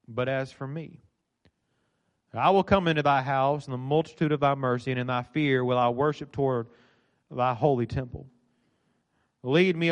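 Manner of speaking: low, measured Bible reading with long pauses; solemn scripture reading. A narrator's reading. Preacher reading the psalmist's prayer to the congregation, solemn. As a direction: read the prayer reverently, phrase by phrase.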